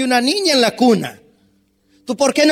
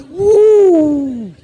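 mocking "uh"; 6 semitones higher, 5 dB louder than usual. Wife mocks husband's courtesy.

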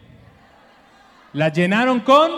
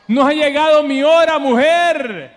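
raised Jesus voice; 6 semitones higher, 6 dB louder than usual. Acted. Preacher voices Jesus refusing.

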